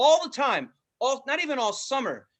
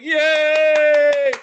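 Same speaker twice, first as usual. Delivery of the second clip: mock cheer; 6 semitones higher, 11 dB louder than usual. Mock Southern tourist cheer.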